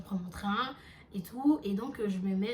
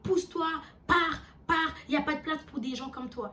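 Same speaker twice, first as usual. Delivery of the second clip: high, loud orders to leave; mock shout, sending someone packing; retold shouting; 6 semitones higher, 3 dB louder than usual. Passenger shouts at the narrator to go away, retold. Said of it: "en me criant dessus".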